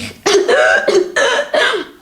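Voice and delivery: high, hammed-up sick cough; exaggerated cough. Mocking a sick boy, hammed-up dramatic cough. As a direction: cough like you're dying of a cold.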